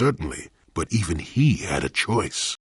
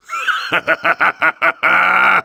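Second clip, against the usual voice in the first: gleeful laugh; 9 semitones higher, 6 dB louder than usual. Acted. Combat victory laugh.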